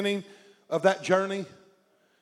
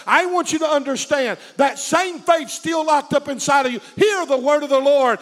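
fiery preaching at full force; 4 semitones higher, 5 dB louder than usual. Acted. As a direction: preach it loud and rising.

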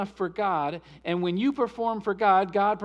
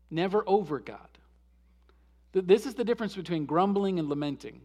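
normal talking voice

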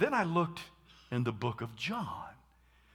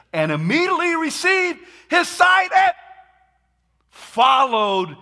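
triumphant proclamation; 15 dB louder than usual. Acted. Climax of the healing reading.

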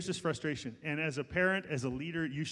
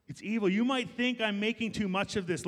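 usual speaking voice

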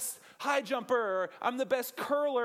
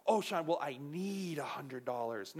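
mock needy voice; 6 semitones lower, 4 dB quieter than usual. Mocking a needy asker.

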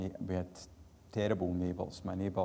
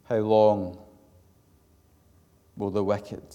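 loud pulpit reading of scripture; psalm lament preached; 9 dB louder than usual. Acted. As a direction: cry the psalm out to the Lord. Reads psalm lament aloud.

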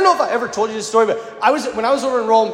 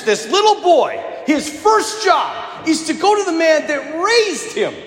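emphatic raised; 9 semitones higher, slower than usual. Real, not acted. Preacher raising voice, emphatic.

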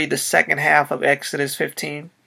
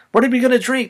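higher, louder complaining demand; 7 semitones higher, 4 dB louder, faster than usual. A narrator's reading. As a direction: throw the question at Moses. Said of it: "they demanded".

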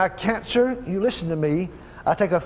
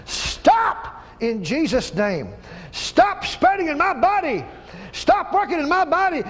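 forceful rebuke; 8 semitones higher than usual. Real, not acted. Rebuking cancer in prayer.

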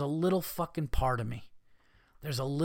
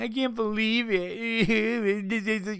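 very slow, higher fake sob voice; mock crying; 9 semitones higher, much slower than usual. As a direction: drag it out like a whiny sob.

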